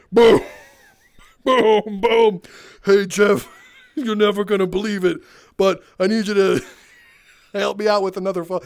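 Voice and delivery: loud, hammed-up hulking customer barging in; booming big-guy greeting. Huge customer greeting tech guy Jeff, loud booming hammed-up voice. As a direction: boom out the greeting like a huge excited regular.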